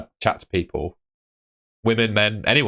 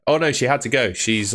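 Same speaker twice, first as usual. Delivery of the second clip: ordinary delivery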